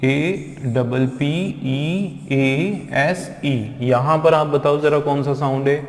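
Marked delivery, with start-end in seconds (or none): 0.0-3.8 letters of the word spelled slowly and softly; slow, soft spelling aloud. Shown as a demonstration. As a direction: dictate each letter to the class. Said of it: "spelling out letters".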